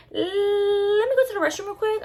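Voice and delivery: high, put-on polite voice; awkward excuse. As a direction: stretch the first sound, then excuse yourself high-pitched. Acting her awkward excuse to leave.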